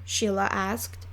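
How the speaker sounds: usual speaking voice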